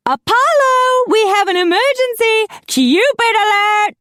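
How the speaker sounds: very high, loud alarm; frantic calling out. A narrator's reading. Macy summons Apollo to an emergency, frantic high call. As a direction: shriek for help like a melodramatic host.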